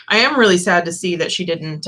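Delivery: usual speaking voice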